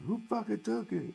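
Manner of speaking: high-pitched replay of the outraged instructor; mock angry yell. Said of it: "he's yelling at the".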